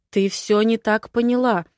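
usual speaking voice

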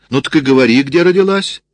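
loud, high, climbing order; exasperated demand. A narrator's reading. Sergeant ordering a woman to name her birthplace, exasperated. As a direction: push up into an impatient shout.

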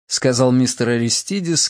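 usual speaking voice

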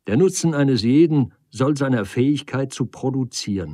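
a hint of jovial ridicule; teasing debater. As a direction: lecture Hansen in animated mockery. Character ridicules Hansen at length.

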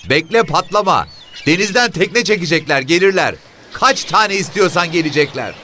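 put-on high, loud old woman's voice; woman shouting back. A narrator's reading. Mother shouting to the officer from the shack, high and loud. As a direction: yell back from inside the shack. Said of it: "Kadın tekrar bağırdı".

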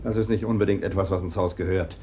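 low, soft cold reply; quiet and low. Acted. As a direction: drop the voice, cutting. Brother answers coldly in argument.

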